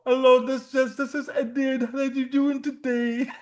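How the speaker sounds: a high, lightly put-on caller voice; mock telemarketer. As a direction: parrot the scammer's greeting back. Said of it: "I imitate them".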